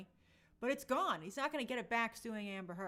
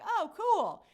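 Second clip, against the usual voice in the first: high, loud, upbeat reaction; retold enthusiasm; 9 semitones higher, 10 dB louder than usual. Host retells learning about an old game, high mock-enthused reaction. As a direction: act out a delighted discovery. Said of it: "And I was like".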